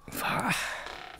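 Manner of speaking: drawn-out hushed うわぁ; whispered groan. Real, not acted. Whispered reaction to co-host's dinner story. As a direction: breathe it out slowly as a whisper.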